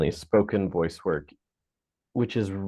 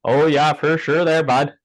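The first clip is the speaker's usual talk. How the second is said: hammed-up Canadian accent, louder and higher; exaggerated Canadian accent; 6 semitones higher, 7 dB louder than usual. Guest joking back at the host in an exaggerated Canadian accent. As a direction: play up a thick Canadian accent for a laugh. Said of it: "I just made it really Canadian, my accent".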